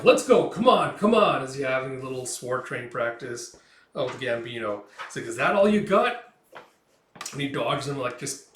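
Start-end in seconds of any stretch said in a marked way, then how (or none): 0.0-1.4 high, loud goading; loud urging. Acted. Gambino goading Guts in sword training, loud urging. 5.3-6.3 loud, high taunt; mock the kid's effort. Gambino taunting little Guts in training, loud taunt.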